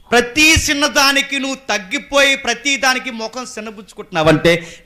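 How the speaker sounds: loud, high preacher's rebuke; full-force preaching. Real, not acted.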